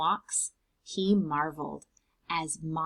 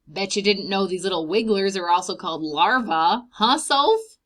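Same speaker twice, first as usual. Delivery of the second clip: playful, a bit louder; lightly voiced old man; 7 dB louder than usual. Mister McGinty teasing Sophie about larvae, playful. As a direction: tease the dog fondly.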